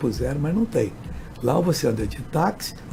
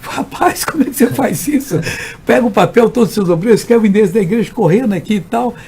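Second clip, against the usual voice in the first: louder, higher exasperated scolding; 6 semitones higher, 5 dB louder than usual. Retold or imitated. Pastor scolding the local pastor about the church address, loud. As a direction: chide him for forgetting the address.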